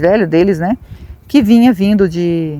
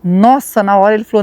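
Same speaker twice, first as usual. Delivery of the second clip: ordinary talk, nothing marked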